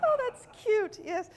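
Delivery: high giggly laugh-talk; forced giggling. Acted. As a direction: squeal at imaginary puppies. Fake laughter practice with audience.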